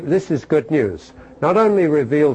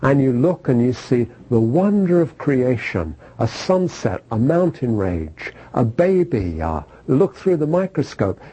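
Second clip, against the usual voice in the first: ordinary talk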